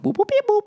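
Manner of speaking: high electronic boop-beep noises; robot beeps. Pretend. A robot butler arriving with liquid food, high beeping boops. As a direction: beep like a clunky old sci-fi robot. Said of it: "some fucking robot will come in".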